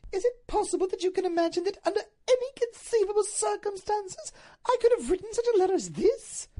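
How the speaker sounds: very high, loud, tearful lady's voice; shocked high-pitched protest; horrified Duchess. Acted. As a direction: wail in wounded disbelief.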